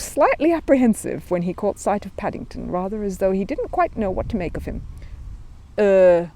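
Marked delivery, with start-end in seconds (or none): 5.8-6.3 hesitant policeman trying German; unsure, careful German phrase; a nervous young constable tries out his German. Acted. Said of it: "he ventured nervously".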